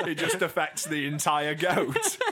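words broken by giggles; laughing. Real, not acted. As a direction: crack up while you say it. Laughing mid-sentence.

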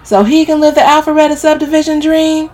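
high, loud indignant rant; protesting. A narrator's reading. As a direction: complain loudly about being stuck. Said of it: "Camille protests".

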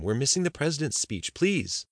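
high-pitched, urging plea; pleading wife. A narrator's reading. Wife tugs at husband's arm.

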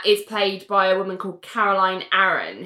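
ordinary talk

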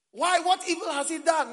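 high, loud pleading cry; shouted plea. Acted. Preacher shouting Pilate's plea to the crowd, high and loud. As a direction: cry out why in protest.

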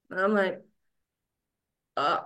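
ordinary delivery

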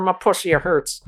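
high, slow, laughing mock whine; mock complaint. Podcaster mimics complaining fan, high and laughing.

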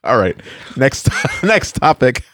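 slow, laughing through the words; can't keep a straight face. Real, not acted. Co-host joking, losing composure.

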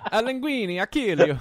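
high, loud mock Italian threat; hammy Italian mobster voice; Italian accent. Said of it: "what other stereotypes can we do".

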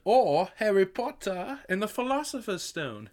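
high, exaggerated British accent. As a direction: answer as a hammed-up Brit. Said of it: "what is it called in Great Britain".

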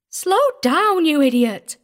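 high, loud snapped warning; sharp snap. A narrator's reading. Hetty snaps at the driver while clinging on, loud. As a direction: bark it at the reckless driver. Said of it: "Hetty snapped at me pleasantly".

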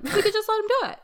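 high, coy pretend suggestion; mock musing. Officials agreeing he could abdicate, mock high-pitched musing. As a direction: chime in high, playing along.

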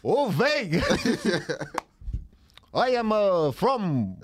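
hammed-up, halting Eastern European-style accent; mock heavy foreign accent; comic old-man accent. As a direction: put on a thick Dracula-like accent, barely keeping it together. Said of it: "I'll, I'll do the accent".

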